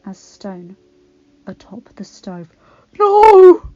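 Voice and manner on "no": loud high cry of horror; horrified. A narrator's reading. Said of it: "he breathed in horror".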